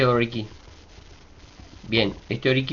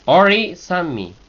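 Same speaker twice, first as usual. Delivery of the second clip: "Ori" lifted on the I, "sanmi" left unaccented and falling; accent on I; 6 semitones higher, 7 dB louder, slower than usual. Shown as a demonstration. Teacher showing the accent, "Ori" stressed on its last vowel. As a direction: stress the final I of "Ori", raised and loud, then let "sanmi" fall. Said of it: "Solo la I de ori lleva, lleva acento".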